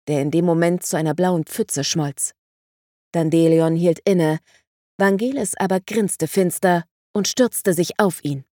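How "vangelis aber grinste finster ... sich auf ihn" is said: ordinary delivery, nothing marked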